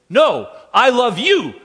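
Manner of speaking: put-on high, loud, slow toddler insisting; mock toddler outdoing her father. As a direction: play a stubborn two-year-old topping dad. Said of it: "she'll say".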